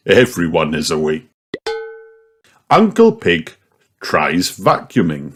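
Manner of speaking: plain reading voice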